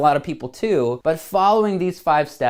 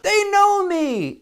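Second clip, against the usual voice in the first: much higher, slow and amazed; delighted surprise; much slower than usual. Retold or imitated. Hearing his name called in a crowd, delighted. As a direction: gasp it out like a happy discovery. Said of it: "And you're like".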